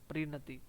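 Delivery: प्रीणति said softly with a falling end; प्रीणति, both इ kept short; soft model word. Shown as a demonstration. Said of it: "दोनों की दोनों छोटी ई की मात्रा". (प्रीणति, नरम स्वर में)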